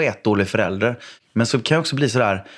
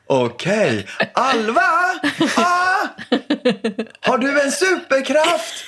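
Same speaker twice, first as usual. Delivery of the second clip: high, theatrical game-master voice; playful game voice; 13 semitones higher, slower than usual. Retold or imitated. Dad calling his son into a pretend mission, high theatrical voice. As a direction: sell it like a grand adventure to the kids.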